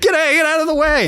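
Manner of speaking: imitated kids shouting him out of the way; kids yelling. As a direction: shout at the uncle blocking the TV. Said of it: "we'd all be like".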